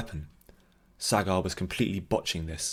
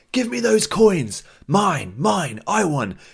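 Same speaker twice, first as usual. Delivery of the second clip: put-on frantic drunk shout; 11 semitones higher, 7 dB louder, slower than usual. Drunk grabbing coins in brawl.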